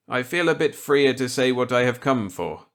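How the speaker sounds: put-on eager reply to an old colleague. Walker accepting Millet's gambit eagerly.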